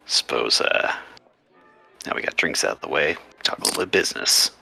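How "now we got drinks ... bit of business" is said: ordinary talk, nothing marked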